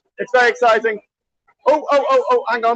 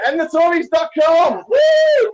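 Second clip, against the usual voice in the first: shouted slogan; 5 semitones higher, slower than usual. Real, not acted. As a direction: shout it to the crowd.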